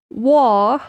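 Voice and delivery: trying a British WAR, drawn out without the R; British accent. Acted. Streamer testing how the British say war, British accent. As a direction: say war as a Brit would, "waw". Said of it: "how do the British say war".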